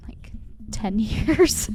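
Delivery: whispered with a laugh; whispering the number through a laugh. Real, not acted. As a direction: breathe it out laughing.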